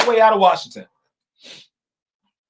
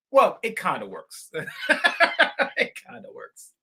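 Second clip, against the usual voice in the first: chuckling, trailing into a soft laugh; 2 semitones lower, 6 dB quieter than usual. Real, not acted. Vlogger joking to viewers about democracy, laughing. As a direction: laugh it off as you undercut yourself. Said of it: "laughing at own caveat".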